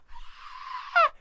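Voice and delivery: high-pitched imitated little-girl scream; startled shriek, acted out. Cindy spotting the Grinch, mock startled scream. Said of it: "and Cindy's like".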